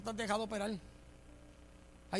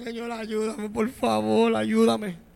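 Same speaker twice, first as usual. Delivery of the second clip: loud, imploring cry for help; imitated tearful plea; 12 dB louder, much slower than usual. Preacher on his knees asking God for help, loud mock-tearful plea. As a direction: beg God loudly as if on your knees. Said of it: "llegar a la rodilla llorando".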